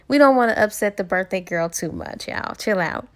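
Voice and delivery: ordinary delivery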